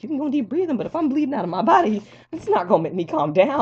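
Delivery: high, loud mock outrage; indignant protest. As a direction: protest shrilly to the medical staff. Retold protest at deep breathing advice.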